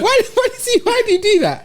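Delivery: high-pitched, laughing disbelief; laughing question. Real, not acted. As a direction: tease a friend through real laughter. Teasing friend about his whispering.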